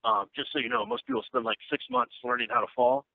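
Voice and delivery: lightly voiced casual wrestling coach, half-joking; imitating the trainer. Wrestling trainer briefing a nervous beginner, in a lightly imitated bro voice. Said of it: "he talks like this".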